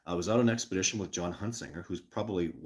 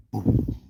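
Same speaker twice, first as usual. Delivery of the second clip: a sudden "boom" hit much louder; loud boom imitation; 10 dB louder than usual. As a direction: let it land like an impact.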